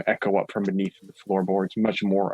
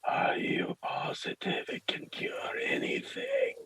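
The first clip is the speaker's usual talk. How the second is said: slow, whispered wary question; wary priest; 10 dB quieter, slower than usual. Acted. Father warily questions the peddler about a cure, slow whisper. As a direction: whisper it slowly, full of doubt. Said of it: "a little warily, but he will say".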